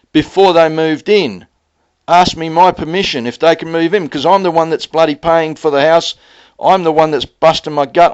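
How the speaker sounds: the husband re-living his furious complaint to his wife; angry retelling. Retold or imitated. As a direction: hammer each grievance home. Retold angry confrontation with wife.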